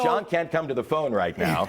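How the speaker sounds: announcer voicemail greeting. Pretend. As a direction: read it like an outgoing message. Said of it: "I have my phone over there".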